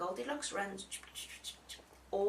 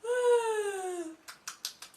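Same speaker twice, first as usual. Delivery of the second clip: long high dramatic sigh; big sigh; 11 semitones higher, 6 dB louder than usual. Acted. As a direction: sigh it out theatrically.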